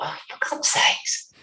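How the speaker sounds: whispered, exasperated aside to herself; exasperated thought. Retold or imitated. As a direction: breathe it out like you've lost patience. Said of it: "and then I thought".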